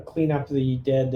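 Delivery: ordinary delivery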